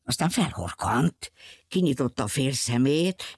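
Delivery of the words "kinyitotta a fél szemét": plain reading voice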